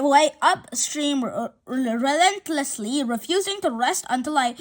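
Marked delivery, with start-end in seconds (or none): none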